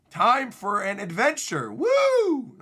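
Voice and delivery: hammed-up adventurer's whoop; excited cheer. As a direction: cheer it out, loud and high.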